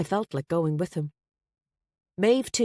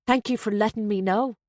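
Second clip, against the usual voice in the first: higher-pitched, petulant retort; 4 semitones higher than usual. A narrator's reading. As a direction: sound hurt and put out. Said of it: "I sounded petulant".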